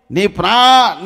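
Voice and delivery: ordinary delivery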